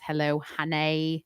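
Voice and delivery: drawn-out "honey" ending in "ay"; show host's catchphrase. Retold or imitated. Co-host quoting a podcast title, lightly imitating. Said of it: "That's how she says it".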